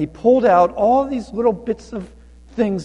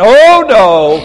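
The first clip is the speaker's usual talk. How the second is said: loud, higher outraged cry; worked-up refusal; 5 semitones higher, 13 dB louder than usual. Acted. Guests worked up at his request, loudly refusing. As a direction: belt out a drawn-out refusal. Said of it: "everybody's getting all worked up".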